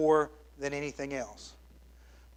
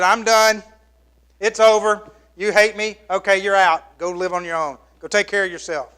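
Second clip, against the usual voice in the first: loud, higher, fed-up parent snapping; angry parent; 4 semitones higher, 9 dB louder than usual. Retold or imitated. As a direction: throw the kid out in mock fury. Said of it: "You said".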